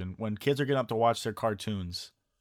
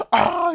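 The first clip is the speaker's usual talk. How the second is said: high, loud yelp of pain; imitated scream; 9 dB louder than usual. Retelling Randy Savage bitten by the cobra, mock scream. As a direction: yell like the snake just bit you. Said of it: "He's, like, screaming".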